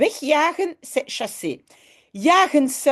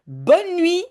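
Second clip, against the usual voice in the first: higher, louder sweet goodnight; lightly voiced kindly aunt tucking children in; aunt Mies saying goodnight; 8 semitones higher, 3 dB louder than usual. Teacher reading the story to students, lightly voicing the aunt. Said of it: "dit tati Mies".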